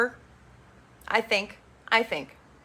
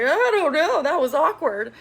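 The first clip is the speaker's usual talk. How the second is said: upset high voice; 8 semitones higher, 5 dB louder than usual. Retold or imitated. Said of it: "And she's like".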